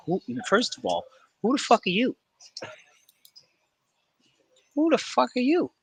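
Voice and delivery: very high-pitched acted indignation; mock incredulous challenge. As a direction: squeak it out as a mock confrontation. Acted reply to random visitors.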